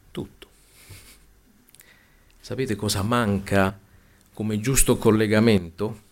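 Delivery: ordinary talk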